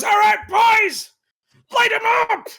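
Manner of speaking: high, loud, slow command; barked order. Acted. Boss orders his goons to shoot, loud high command. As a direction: shout the order to open fire.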